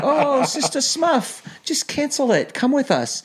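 very high, stretched-out mock Kiwi pleading; mocking New Zealand accent. Elder coaxing sister missionary to cancel, mocking New Zealand accent. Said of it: "she knew I was mocking her".

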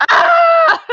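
sudden high startled cry; startled yelp. Real, not acted. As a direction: jump at a fright. Startled by something unexpected.

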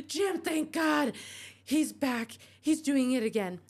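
frightened wife pleading on the phone; scared wife begs Jim on the phone, panicked. Acted.

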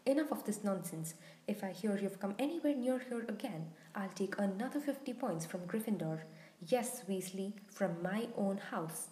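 ordinary delivery, nothing marked

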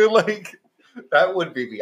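normal talking voice

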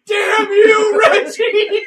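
Tails' shout. Retold or imitated. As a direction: shout after the fleeing man. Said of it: "and he goes".

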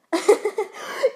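a high, loud burst of mocking laughter; gnome's scornful cackle. A narrator's reading. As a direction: laugh her off, the gnome finds it absurd. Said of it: "laughing her head off still".

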